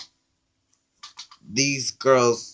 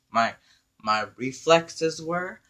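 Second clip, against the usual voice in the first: usual speaking voice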